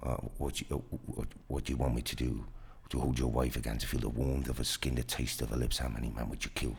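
low, threatening American thriller voice; American accent. Shown as a demonstration. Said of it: "Zo praat ik daar". (lage, dreigende Amerikaanse stem)

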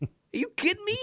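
put-on very high, incredulous Milwaukee accent; mock outrage in Milwaukee accent, pitch through the roof; screaming Milwaukee accent. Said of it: "screaming at each other".

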